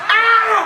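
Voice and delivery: shrill mock scream of pain; high kid scream. Boy yelling while his mama hits him, high scream.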